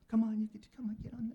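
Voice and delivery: high breathy whisper to a spider; coaxing whisper. Retold or imitated. Ex-wife coaxing the spider onto paper, high whispered coaxing. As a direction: coax the little spider gently.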